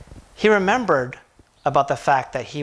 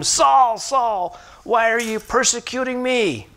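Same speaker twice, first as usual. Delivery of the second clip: calling voice of the Lord; 7 semitones higher, slower than usual. Acted.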